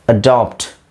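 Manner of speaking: ordinary talk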